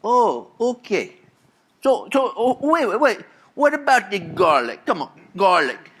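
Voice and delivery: high, eager Italian-American son voice. Retold or imitated. Son pestering mama about the garlic, high eager voice. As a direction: pester her like an impatient kid. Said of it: "He goes".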